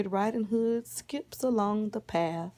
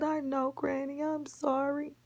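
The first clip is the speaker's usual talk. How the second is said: small, high, soft mock-tearful child; understated sorry little voice; tearful apology; 6 semitones higher, 5 dB quieter than usual. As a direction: apologise softly, a touch of pretend crying.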